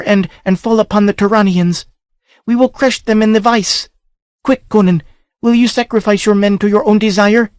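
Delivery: high female voice, urgent orders; princess, commanding. A narrator's reading. Princess urges Conan to give her his horse, high and urgent. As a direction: command him quickly, high.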